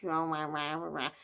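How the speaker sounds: low, soft droning mumble; mock depressing voice. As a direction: imitate a dreary audiobook reader. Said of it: "I just found it really depressing".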